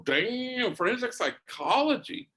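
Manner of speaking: high, rising amazed repeat of forensic psychology; wow, she really said forensic psychology; impressed surprise. Retold or imitated. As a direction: savor each word like you can't believe it. Priest impressed by her answer.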